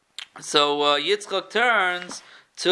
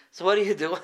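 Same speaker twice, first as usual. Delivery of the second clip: ordinary delivery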